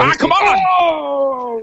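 drawn-out outraged groan; exasperated protest. Real, not acted. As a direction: stretch it out in disbelief. Protesting losing the quiz.

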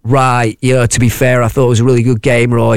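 ordinary talk, nothing marked

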